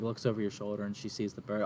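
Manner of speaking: ordinary talk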